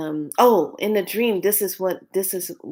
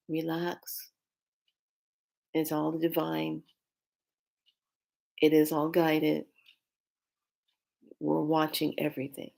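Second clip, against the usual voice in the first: calm reassurance; 6 dB quieter than usual. Retold or imitated. Calm spirits reassuring her.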